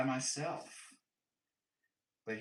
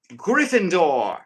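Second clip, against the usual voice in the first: big, slow, booming announcement; sorting hat shout; 6 semitones higher, 12 dB louder, slower than usual. Pretend. Streamer booming out a house name as the hat.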